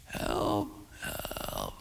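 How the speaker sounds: breathy, barely audible help; faint cry for help. Retold or imitated. Injured old man answering faintly from the woods. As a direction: whisper it like a weak voice from far away. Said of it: "really faint".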